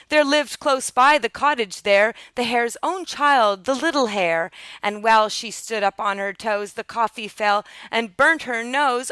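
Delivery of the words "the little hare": plain reading voice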